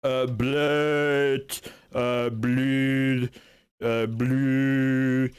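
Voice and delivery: drawn-out, slurred nonsense syllables; numb-faced babble. Pretend. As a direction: babble as if your face has gone numb. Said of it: "you can feel your face going numb".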